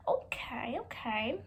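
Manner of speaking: hushed, rising whispered okays. Retold or imitated. Hearing his answer, stunned whispered okays. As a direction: breathe the okays out softly.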